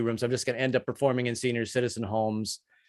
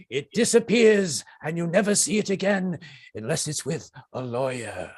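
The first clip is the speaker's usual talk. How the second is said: lightly voiced Attenborough; 5 semitones higher than usual. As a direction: advise the viewers gravely. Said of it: "I'm David Attenborough".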